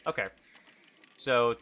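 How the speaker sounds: normal talking voice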